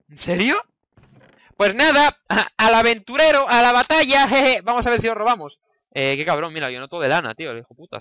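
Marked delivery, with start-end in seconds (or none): 1.6-5.6 high, loud mock heroic charge; playful battle cry. Real, not acted. Gamer charging into battle as an adventurer, loud playful. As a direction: send yourself off like a hero.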